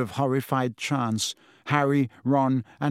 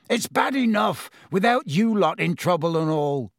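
higher, fiercely insistent scolding; 5 semitones higher, 3 dB louder than usual. A narrator's reading. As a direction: scold them fiercely to send them off. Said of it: "said Hagrid fiercely".

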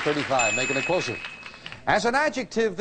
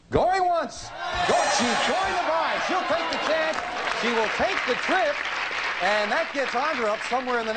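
rising excitement, high and bright; excited; 9 semitones higher than usual. Real, not acted. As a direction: build it up like an auctioneer. Host takes a risky bid.

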